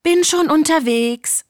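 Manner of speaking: high, loud called reply; calling out. A narrator's reading. Hen hurries to serve the cat, calling out. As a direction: sing out that you're coming.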